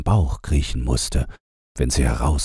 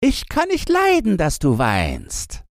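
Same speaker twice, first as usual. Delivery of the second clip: put-on high, pert girl's voice; lightly voiced girl; robber girl voice. A narrator's reading. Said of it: "sagte das kleine Räubermädchen".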